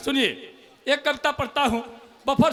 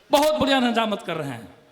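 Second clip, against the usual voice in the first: ordinary delivery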